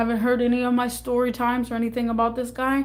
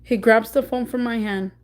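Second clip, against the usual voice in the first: ordinary delivery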